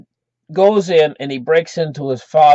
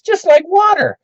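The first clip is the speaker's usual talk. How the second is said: put-on much higher, cocky kid voice; boastful kid; 3 dB louder than usual. Jimmy brags to watching kids after swigging vodka, high boastful kid voice. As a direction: pitch it way up like a boy showing off.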